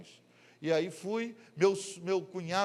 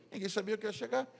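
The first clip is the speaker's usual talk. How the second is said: ordinary talk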